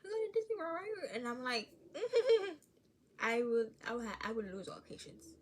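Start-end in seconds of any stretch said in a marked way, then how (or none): 0.0-1.1 put-on much higher eager kid voice; whiny kid pestering; nagging child. Child pestering mom again about Disney, eager and high. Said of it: "my child was like". 1.7-2.6 loud squealing laugh; high burst of laughter. Real, not acted. Vlogger laughing at her own bit, loud and high.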